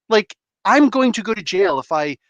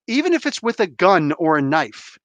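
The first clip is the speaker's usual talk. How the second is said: usual speaking voice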